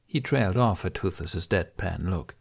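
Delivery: usual speaking voice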